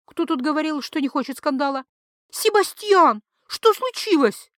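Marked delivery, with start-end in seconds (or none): 2.3-4.5 much higher, louder, slow wail; wife crying out to her husband. A narrator's reading. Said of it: "вопя на весь дом". (вопль жены)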